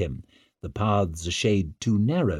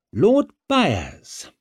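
raised, pointed greeting; dash of resentment; 10 semitones higher, 4 dB louder than usual. A narrator's reading. King greeting the old meddler in the gardens, resentful. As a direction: greet him with a touch of grudge. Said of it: "came out with more than a dash of resentment".

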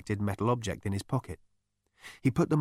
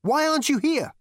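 loud, very high exasperation; agitated demand; 6 dB louder than usual. A narrator's reading. Zaphod to the invisible voice, agitated.

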